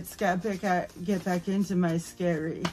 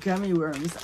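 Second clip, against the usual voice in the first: ordinary talk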